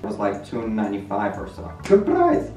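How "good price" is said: very high mock praise; squeaky joke. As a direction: squeak it playfully.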